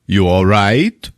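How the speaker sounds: slow, drawn-out question rising at the end; Javier. Retold or imitated. Javier checks on her from the other room, slow rising call. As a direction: imitate a casual call, stretched right out. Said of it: "you hear Javier go".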